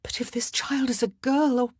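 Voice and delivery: high, fearful unfinished thought; anxious rise, left hanging; dread, breaking off. Acted. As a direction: start the fear, then fail to voice it. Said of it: "she could not voice it".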